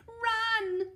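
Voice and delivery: high loud shout; shout to run. Acted. Mum telling Eddie to flee, high loud shout. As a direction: yell it urgently. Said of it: "shouted Mum".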